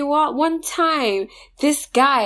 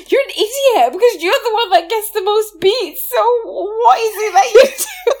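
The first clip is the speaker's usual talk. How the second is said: higher and louder, laughing through the words; laughing shout, much higher; laughing mockery; 11 semitones higher, 5 dB louder than usual. Real, not acted. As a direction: half-shout it while cracking up. Mocking David, laughing.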